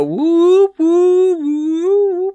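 high whooping siren sound; siren imitation. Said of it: "you just go".

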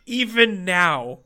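slow, grave pronouncement; mock solemn. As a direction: drag it out like a stern judge.

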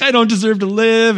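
high, loud mock wail; emotional child. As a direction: imitate an overwrought little boy, melodramatic. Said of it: "he's, like, all emotional".

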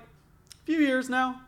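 high, slow and drawn out, faking confidence; mock casual bluff. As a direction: stretch it out like a bluff you hope works. Retold bluff to shop owner.

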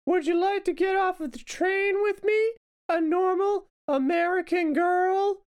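put-on very high, drawn-out girl voice; hammed-up American girl in a skit. Acted train skit, American girl.

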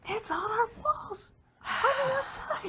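mock wailing. Player wails about the curse.

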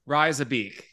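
a try at an Aussie "Rise a beak" with the vowels bent; attempted Australian accent. Shown as a demonstration. Host tries Australian for his guest, proudly attempting the accent. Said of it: "Did it sound right?".

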